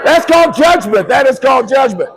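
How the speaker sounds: loud, high proclamation of the word judgment; booming announcement. Acted. Comedian booming the punchline at the audience. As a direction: call it out big like a verdict.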